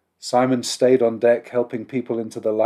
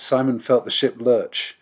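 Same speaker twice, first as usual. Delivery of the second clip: ordinary delivery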